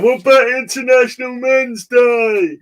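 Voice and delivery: loud, high, drawn-out mocking whine; mock men on Twitter. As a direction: imitate an indignant online complainer, slow and loud. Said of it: "you always get men on Twitter going".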